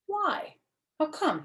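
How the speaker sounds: high, startled questions; puzzled boy caught off guard; surprised. Acted. Surprised boy asking his girlfriend why.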